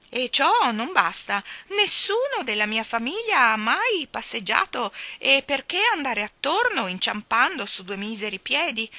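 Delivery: put-on high, loud squawky old woman; eccentric old lady. Miss Calico boasting to the children, high and loud. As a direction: keep the shrill hen voice going.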